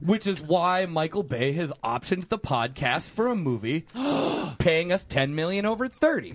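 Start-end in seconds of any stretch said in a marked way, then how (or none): 3.9-4.5 high dramatic gasp; sharp theatrical intake of breath. Pretend. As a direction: gasp as if amazed. Mock-dramatic announcement of movie deal.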